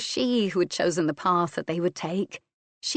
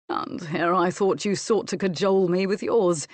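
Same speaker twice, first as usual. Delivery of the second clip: teasing; 3 dB louder than usual. A narrator's reading. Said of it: "he teased her".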